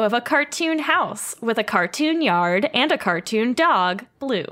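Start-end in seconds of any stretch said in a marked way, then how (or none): none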